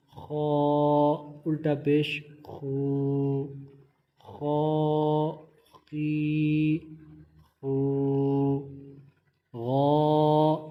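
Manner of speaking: each letter and vowel drawn out slowly and clearly; تلفظ کی مشق، آہستہ اور واضح; slow spelling drill. Shown as a demonstration. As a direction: spell it out for a beginner, stretching قوف.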